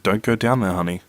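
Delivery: plain reading voice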